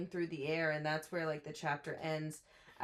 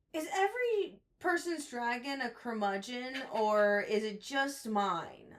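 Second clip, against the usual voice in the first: higher-pitched mock wondering; incredulous questioning; 5 semitones higher, 3 dB louder than usual. Podcast host acting out the heroine questioning her dragon, incredulous.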